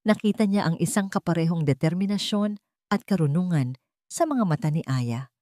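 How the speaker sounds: usual speaking voice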